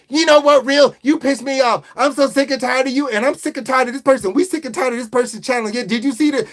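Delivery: hammed-up angry rant; mock rant. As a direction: tell them off, sick and tired. Mock-scolding the trolls.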